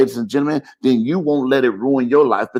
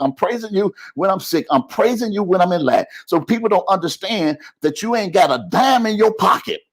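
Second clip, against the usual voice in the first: higher, building sermon climax; preaching at full force; 5 semitones higher than usual. Acted. Preacher rousing the midday congregation, full-force preaching.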